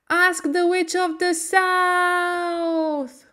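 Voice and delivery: high, slow call from far off; far away voice. A narrator's reading. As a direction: stretch it out like a voice fading in the sky. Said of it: "voice very far away".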